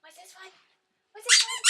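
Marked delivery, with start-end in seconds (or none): 1.2-1.6 high, loud excited squeal. Real, not acted. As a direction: squeal the question with delight. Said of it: "repeated excited high".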